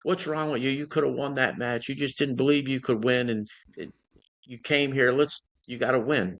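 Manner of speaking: high-pitched retold coach yelling at his wrestler; a coach chewing out his wrestler, mock yelling. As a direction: bark at him like an angry coach after a lost match. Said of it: "He's yelling at me all the way".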